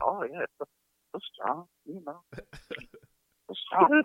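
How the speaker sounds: imitating the masseuse, very soft and coy; cooed flattery. Masseuse making client feel big and strong, cooed flattery. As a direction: murmur admiring little compliments, barely voiced.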